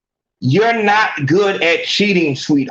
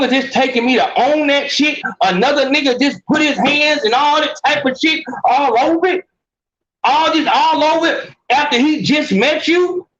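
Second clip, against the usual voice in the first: higher, worked-up indignant question; incredulous outrage; 6 semitones higher than usual. Real, not acted. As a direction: voice climbing higher in disbelief. Outraged rant at cheating partner.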